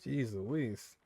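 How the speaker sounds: ordinary delivery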